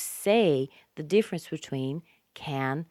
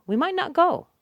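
"might not go" high and louder, run together; lively, raised "we might not go"; quick natural demo; 13 semitones higher, 6 dB louder than usual. Shown as a demonstration. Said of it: "So we make it very fast".

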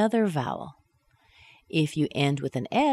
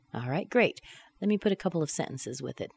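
normal talking voice

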